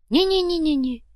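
high, rapid string of denials; hasty backing down. A narrator's reading. Fuhrer backs down from Kumar, hastily protesting. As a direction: back off in a squeaky protest. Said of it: "замахал руками Одноглазый".

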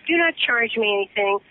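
ordinary delivery

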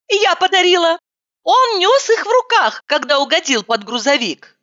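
high, dismayed confession; high girlish voice. Acted.

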